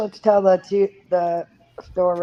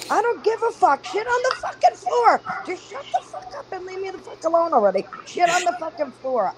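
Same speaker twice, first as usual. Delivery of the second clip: high, fed-up retold rant at the kids; furious high-pitched mom venting; exasperated rant; 11 semitones higher than usual. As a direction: spit it out like a mom at her wits' end. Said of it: "I'm like".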